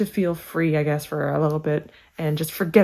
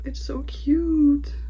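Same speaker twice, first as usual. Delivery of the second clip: much higher, softer, half-whispered gushing; cute gushing; 8 semitones higher, 4 dB quieter, slower than usual. Real, not acted. Fan gushing over a friend's handmade bookmark, high and soft. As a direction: melt over it, airy and high.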